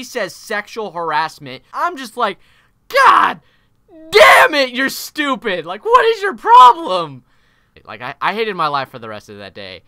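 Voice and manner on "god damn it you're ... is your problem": very high, loud, hammed-up outburst; exasperated yell. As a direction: blow up in exaggerated frustration. Retold outburst at accusing coworker.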